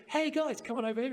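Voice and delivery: high, eager call to friends; excited calling out. Retold or imitated. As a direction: call the group over, thrilled. American calls his friends over.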